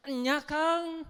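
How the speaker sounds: Sundanese woman. Acted. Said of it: "kata si perempuannya".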